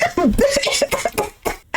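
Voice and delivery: high, voiceless giggle; long wheezy laugh. Real, not acted. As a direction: dissolve into airy laughter. Overcome by the scene.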